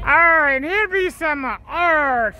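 ordinary delivery

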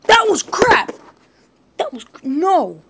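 loud, high outburst at the game; angry shout. Real, not acted. Gamer losing a fight, yelling angrily. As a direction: yell it in frustration.

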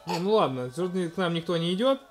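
normal talking voice

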